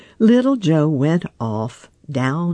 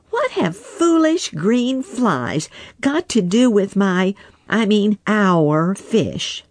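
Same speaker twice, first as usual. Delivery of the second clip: angry otter; 7 semitones higher than usual. Acted. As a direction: hurl the question back, higher. Little Joe retorts.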